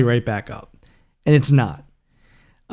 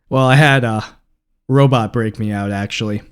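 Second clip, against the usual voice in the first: ordinary delivery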